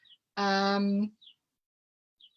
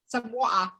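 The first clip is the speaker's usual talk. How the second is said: British "water" with no t; British "wa'er" with a falling end; British accent. Shown as a demonstration. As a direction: repeat "water" British-style, dropping the t. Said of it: "very British in a British accent".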